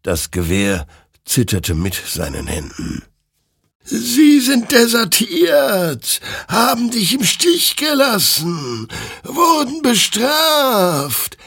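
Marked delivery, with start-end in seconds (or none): 3.8-11.4 very high, very slow eerie choir of voices; chorus of souls. Acted. The flaming souls answer Hektor in eerie chorus. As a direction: sound like many burning souls at once. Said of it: "erklärten die flammenden Seelen ihm im Chor".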